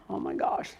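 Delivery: breathy shocked whisper; a hushed oh my gosh at the price; gasped whisper. Retold or imitated. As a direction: whisper it in mock panic. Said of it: "and then go".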